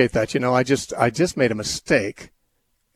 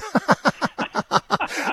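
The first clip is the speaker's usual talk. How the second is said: real laugh. Real, not acted. Host laughs at own mispronunciation.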